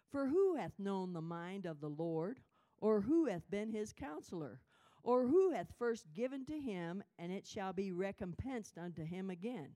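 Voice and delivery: loud, slow, ringing proclamation; preacher declares Romans eleven, loud and slow; preaching scripture. Real, not acted. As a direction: preach it with full force. Reading verses in preaching voice.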